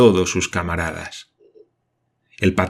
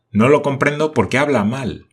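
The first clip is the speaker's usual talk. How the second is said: impatient; 5 semitones higher, 4 dB louder than usual. A narrator's reading. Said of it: "empezaba a impacientarse".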